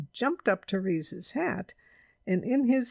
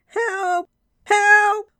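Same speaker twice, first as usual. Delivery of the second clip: high, loud shout for help; urgent, high-pitched yell; very loud call; 14 semitones higher, 12 dB louder than usual. A narrator's reading. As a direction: yell out at full volume. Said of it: "then very loudly".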